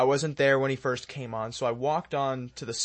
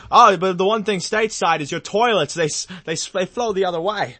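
put-on higher, lively Aussie accent; Australian accent; 4 semitones higher, 4 dB louder than usual. Australian at the booth explaining toilets, imitated Australian accent. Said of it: "an Australian voice".

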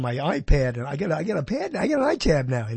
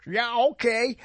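higher-pitched mock Southern manager; imitated Southern accent; 6 semitones higher than usual. Manager backing off from the customer, imitated Southern accent. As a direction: answer as a put-on Southerner. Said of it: "I'm imitating him rather well".

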